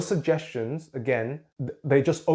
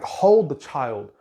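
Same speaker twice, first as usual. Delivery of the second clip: ordinary talk, nothing marked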